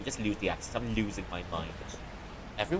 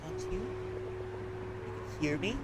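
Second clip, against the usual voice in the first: much softer, almost whispered; soft ghostly hush; 2 semitones higher, 11 dB quieter than usual. Acted. Ghost telling Mark she is still here, softly hushed. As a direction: speak like a fading ghost.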